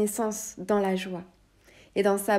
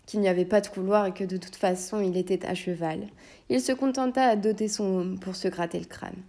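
ordinary delivery

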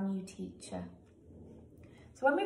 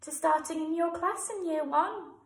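higher, bright example read-out; example sentence; 7 semitones higher than usual. Shown as a demonstration. Teacher giving pupils an example sentence, raised pitch. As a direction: lift up for the model sentence. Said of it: "you might say something like".

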